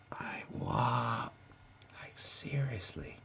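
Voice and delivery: barely voiced, slow; hushed and baffled; stunned whisper. Real, not acted. Stunned disbelief aside.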